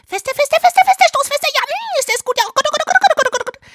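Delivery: shrill repeated cries of pleasure; mock ecstasy. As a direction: overact the climax.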